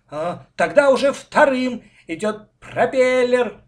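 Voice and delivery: high, loud, joyful shout. A narrator's reading. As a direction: shout it out, stretching the words. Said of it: "закричал Коля".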